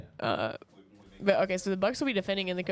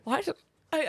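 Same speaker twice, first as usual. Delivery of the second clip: hiccup squeak; 6 semitones higher than usual. Real, not acted. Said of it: "I sound like a dolphin there".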